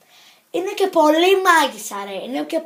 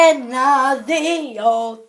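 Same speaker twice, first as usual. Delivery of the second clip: ordinary delivery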